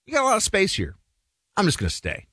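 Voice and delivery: lightly voiced freeloader deciding to stay; entitled guest. Host voicing guest refusing to leave the basement, smug. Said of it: "that guy looks at you and goes".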